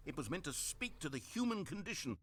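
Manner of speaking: ordinary delivery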